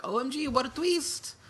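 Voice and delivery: very high, squealed fake astonishment; hammed-up, high-pitched shock; mock amazement. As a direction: gush in a high fangirl-style voice. Mimics gushing reaction to twist.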